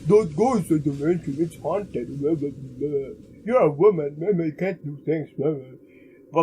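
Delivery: lightly voiced nagging local driver; hint of a mocking impression of a local driver; mock driver's warning. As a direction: parody a dismissive warning, trailing into blah-blah. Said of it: "the local driver's gonna warn us".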